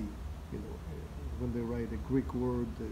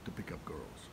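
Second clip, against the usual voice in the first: whispered; 7 semitones lower, 11 dB quieter than usual. Real, not acted. Sly aside about boys.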